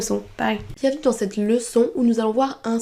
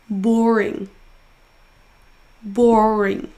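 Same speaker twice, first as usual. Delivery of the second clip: BOR in "Boring" long and strong; stressed syllable drawn out; 4 dB louder, much slower than usual. Shown as a demonstration. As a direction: stretch the first syllable of "Boring". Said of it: "en prononçant plus fort la syllabe". (première syllabe de « Boring » traînée)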